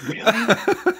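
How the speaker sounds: high startled question; retold surprise. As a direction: squeak it out like a kid just learning his own voice. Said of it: "and I went".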